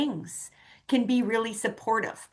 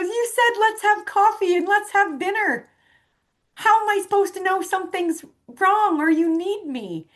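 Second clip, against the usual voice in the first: high, louder indignant complaint; retold upset; 10 semitones higher, 4 dB louder than usual. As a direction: argue back as the exasperated friend. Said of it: "He was mad. He was upset".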